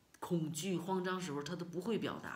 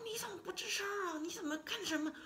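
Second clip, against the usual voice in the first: anxious urgent plea. Retold or imitated. Panicking wife pressing silent husband.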